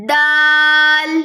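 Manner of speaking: دال held long and sung, ending on a clear ل; chanted دال; drawn-out letter name. Shown as a demonstration. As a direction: stretch دال slowly with a ringing ل. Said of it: "آخر میں لام کی ساؤنڈ آئے گی".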